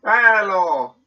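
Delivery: loud, bright greeting call; loud hello. Real, not acted.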